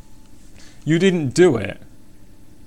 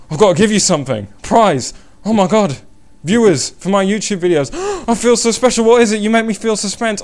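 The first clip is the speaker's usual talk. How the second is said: higher, louder gushing mock thrill; hammed-up excitement; 9 semitones higher, 5 dB louder than usual. Streamer playing up suspense to viewers, hammed-up excitement. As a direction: gush as if handed a prize, over the top.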